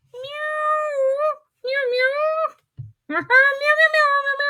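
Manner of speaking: high little cat meows with a laugh; cat meowing. Acted. Host voicing Mitch the cat for the kids, high meows. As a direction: meow like a small stuck cat.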